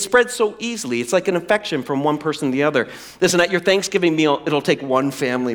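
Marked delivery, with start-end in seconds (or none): none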